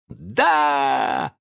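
high drawn-out yes; answering cheer. A narrator's reading. Boys answer their leader from all sides, cheering. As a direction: call back eagerly. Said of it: "раздалось со всех сторон".

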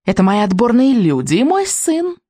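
witch voice with a vile note; nasty witch. Acted. Witch presenting her son, nasty note. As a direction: gloat nastily, like a witch. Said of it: "в её голосе послышалась особенно гнусная нотка".

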